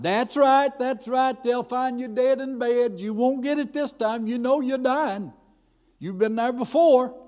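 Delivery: high, loud devil hammering out threats; taunting devil. Retold or imitated. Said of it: "finally the devil said".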